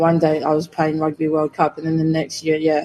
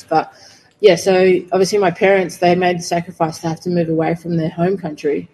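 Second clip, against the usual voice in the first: usual speaking voice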